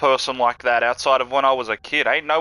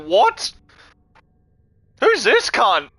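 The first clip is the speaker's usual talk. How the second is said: high, louder mock disbelief; incredulous what; 9 semitones higher, 5 dB louder, slower than usual. Reacting to the kangaroo video, louder mock disbelief. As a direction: react like you can't believe this bloke. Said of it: "I'm like".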